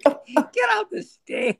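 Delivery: high, loud, slow yell; mock stage yell. Bandmate yelling at him to quit singing, mock furious shout. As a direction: bark it out like a fed-up bandleader. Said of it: "He's like".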